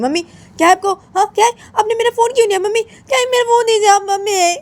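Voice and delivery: high, loud, wailing protest; outraged daughter. Acted. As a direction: shriek at mummy to hand it back. Daughter demands her phone back. (चीखकर विरोध करती बेटी)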